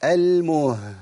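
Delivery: "المه" ending on a long breathed h; isolated ha sound. Shown as a demonstration. Teacher points out the Ha sound, audible ha. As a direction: stop on "المه" and let the h flow out. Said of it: "ده صوت الهاء".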